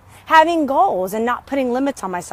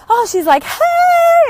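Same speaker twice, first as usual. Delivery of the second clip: a much higher, louder bubbly "hey"; mock happy greeting; 5 dB louder, slower than usual. Vlogger imitates how viewers see her now, mock bubbly hello. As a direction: parody her own all-happy self. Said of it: "and all happy and stuff".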